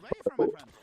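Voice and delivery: yelled high and loud. Real, not acted. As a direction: shout the question across the map. Asks, then repeats it loud.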